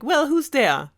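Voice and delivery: lightly voiced old woman calling out; old grandmother. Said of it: "the grandmother in there is like".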